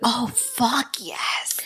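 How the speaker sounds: slow excited whisper. Real, not acted. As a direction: breathe it out in awe. Excited reaction to night witches.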